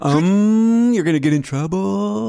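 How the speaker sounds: loud, high, drawn-out schoolyard singsong; mock tattletale. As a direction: taunt like a kid telling on someone. Listener playfully mimics a tattling child.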